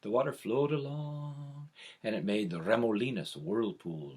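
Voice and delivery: ordinary delivery, nothing marked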